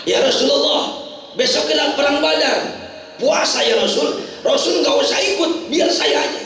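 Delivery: high-pitched, eager follower pleading; volunteer yourself earnestly, voice pitched high; earnest plea. Retold or imitated. Disciple begs the Prophet to stay.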